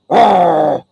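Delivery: loud doggy growl; short snarling dog noise; imitated dog growl. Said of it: "he would, like, he would give".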